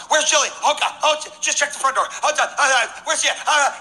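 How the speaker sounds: high, hurried panic; frantic parents searching for a lost child, acted out; freaking out. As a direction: rattle it out in a high-pitched panic. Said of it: "like, freaking out".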